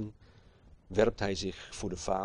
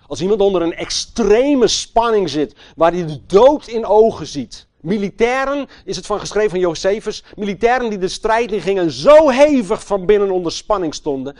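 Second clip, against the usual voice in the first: loud, high sermon voice; fiery preaching; 7 semitones higher, 12 dB louder than usual. Acted. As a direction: preach it with full force. Preaching at full force.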